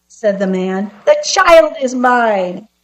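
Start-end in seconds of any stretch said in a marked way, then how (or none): 1.0-2.7 high, slow gloating little man; haughty gloating. A narrator's reading. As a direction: draw out the claim, savouring victory. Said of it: "man relaxed and let out a haughty laugh".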